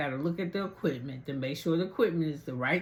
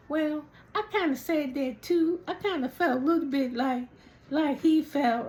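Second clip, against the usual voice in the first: mimicking Chris; 10 semitones higher than usual. Retelling Chris's excuse in voice.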